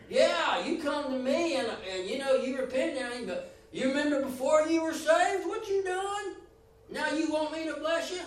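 high, loud mock accusing God; mocking unforgiving God. Preacher imitates a grudging God taunting you, high and loud. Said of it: "How would you like it if God did you that way".

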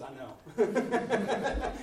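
chuckling high agreement; laughing. Retold or imitated. Brother Paco agrees with a laugh. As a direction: say it through a laugh.

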